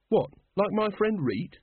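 high-pitched, mildly horrified question; understated horror, pitch jumping up. A narrator's reading. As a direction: ask it in dismay.

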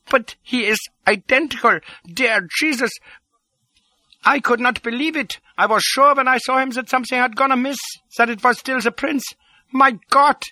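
very high, excited little man; astounded doctor. A narrator's reading. Doctor discovers the prince's double, astounded and high. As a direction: babble in amazement, pitched right up.

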